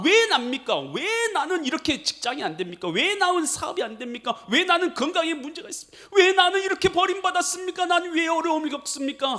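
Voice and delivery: complaining prayer. Retold or imitated. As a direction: whine why me at God. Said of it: "하나님 앞에 늘 원망불평하잖아요".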